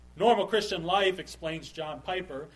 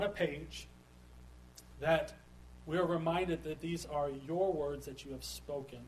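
softer, measured praying voice with pauses; prayer; 3 semitones lower, 6 dB quieter than usual. Real, not acted. Pastor thanks the Father for his word, hushed prayer. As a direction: pray quietly and unhurriedly, phrase by phrase.

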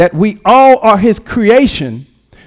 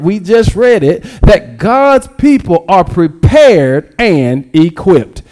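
preaching; 3 semitones higher than usual. Acted. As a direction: preach it with building force. Preaching builds.

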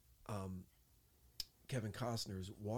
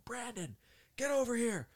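high, urgent call across a distance; retold shout, pitched way up; 14 semitones higher, 9 dB louder than usual. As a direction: imitate a boss hollering someone over. Matt calls him over.

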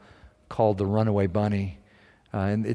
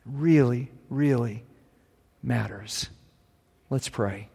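quiet, slow, earnest close; soft sermon close; 3 dB quieter, much slower than usual. Acted. Pastor softly urging the church as he ends, quiet and slow. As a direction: drop it down and let it land.

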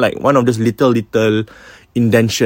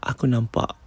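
hushed; 7 dB quieter than usual. Retold or imitated. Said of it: "She said".

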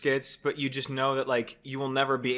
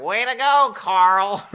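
mock cheer; 6 semitones higher, 8 dB louder, much slower than usual. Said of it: "someone in the back's like".

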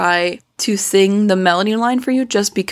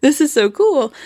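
recalled first-listen excitement; amazed whoa; 5 semitones higher than usual. Retold or imitated. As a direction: gush like hearing it for the first time. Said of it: "I was like".

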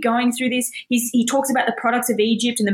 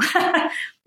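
brief higher-pitched chuckle; short laugh; 8 semitones higher than usual. Real, not acted. Preacher urging Christians not to limit God, brief laugh. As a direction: let a small laugh slip out. Amused at own exhortation.

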